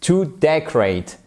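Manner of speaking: decorate with the weight on "de"; stress on first syllable. Shown as a demonstration. Teacher showing the verb's accent, decorate stressed on "de". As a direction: hit the "de" in decorate, let the rest fall. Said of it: "sur la première syllabe".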